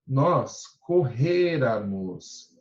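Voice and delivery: "correramos" slow, weight on "re"; stressed syllable shown. Shown as a demonstration. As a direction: stretch "correramos" to show its accent. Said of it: "olha o acento".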